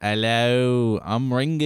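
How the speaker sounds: slow, hammed-up Australian Ringo; mock Australian accent. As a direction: drawl it like a bad Aussie. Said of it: "now you're sounding like Crocodile Dundee".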